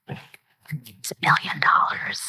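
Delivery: slow, hushed whisper. Real, not acted. Storyteller revealing the huge winnings to listeners, whispered. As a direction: whisper it like a staggering secret.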